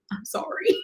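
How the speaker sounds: breathy whispered apology; sheepish whisper. Retold or imitated. Apologizing to viewers for talking too fast, whispered. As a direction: whisper it like a guilty kid. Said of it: "and I'm like".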